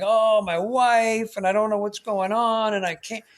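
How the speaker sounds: mock a man whining about his wife. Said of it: "like, whining".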